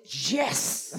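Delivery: punchy mock-triumphant yes; inner cheer. Preacher replaying his inward relief at the greeting, triumphant cheer. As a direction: celebrate like you just got away with it. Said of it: "Dentro de mim, eu falei".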